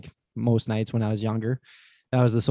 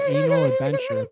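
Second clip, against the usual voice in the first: squeaky; 4 dB louder than usual. Real, not acted. Comic squeak on punchline.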